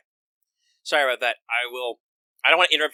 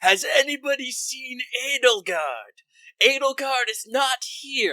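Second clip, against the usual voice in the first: high, slow, stilted acting voice; Hubert's bad acting; 12 semitones higher, much slower than usual. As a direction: overact each word stiffly, way up high. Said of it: "Hubert's bad acting".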